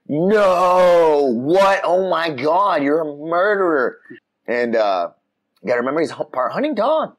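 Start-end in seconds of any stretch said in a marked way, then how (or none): 0.1-4.0 loud, high, horrified cry; shocked outcry. Retold or imitated. Owner sees his dog kill a squirrel, loud shocked outcry. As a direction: cry out in disbelief, dragging Oh my God. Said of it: "And I was just like".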